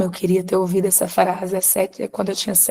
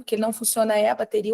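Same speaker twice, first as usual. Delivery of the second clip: ordinary talk, nothing marked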